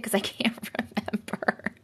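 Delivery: breathy laughing whisper. Real, not acted. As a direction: whisper it with a giggle. Whispered aside.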